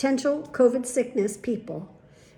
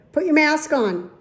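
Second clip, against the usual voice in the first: louder, higher repeated command; insistent order; 4 semitones higher, 6 dB louder than usual. Retold or imitated. Patient repeats it to puzzled old man, insistent. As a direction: raise the voice at someone who didn't get it.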